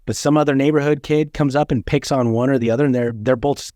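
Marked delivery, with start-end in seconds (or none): none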